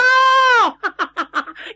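high, ringing burst of laughter; delighted laugh. Acted. Qi Ruhua laughs aloud, pleased the young general knows her.

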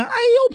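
sudden high yelp of pain; a sharp cry as the blade hits; scream of pain. A narrator's reading. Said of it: "猛然间就听见". (突然一声高亢的惨叫)

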